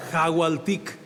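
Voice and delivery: Jagualtic con J inicial, como jota; pronunciación corregida. Shown as a demonstration. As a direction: empieza Jagualtic con una jota marcada. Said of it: "Co-, con J". (tras la corrección, Jagualtic con J)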